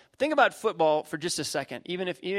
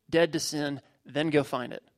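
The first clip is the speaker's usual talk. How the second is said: ordinary delivery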